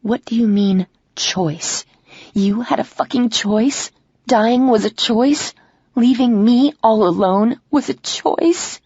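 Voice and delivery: hurt fury climbing word by word; rising anger. Acted. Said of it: "the apprehension I felt earlier turns to anger".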